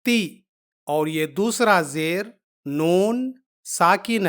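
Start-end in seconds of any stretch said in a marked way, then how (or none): 0.1-0.4 تی as a short isolated "ti"; one quick "ti" for تی, no n after it; sound demonstration. Shown as a demonstration. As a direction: model تی cut short on its vowel. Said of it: "اس کی آواز ہے".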